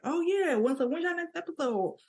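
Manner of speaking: high-pitched imitated enthusiasm; eager listener. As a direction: ask like a keen fan.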